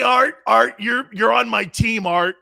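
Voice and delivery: loud, high call of his name; thrilled holler; excited shout. Real, not acted. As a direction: fire him up with joy. Excited yelling at caller.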